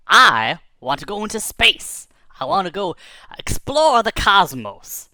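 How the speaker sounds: big voice, rising pitch, savoring each word; grand declaration. Acted. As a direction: proclaim a grand dream, stretching the key words. Bird declares its grand ambition.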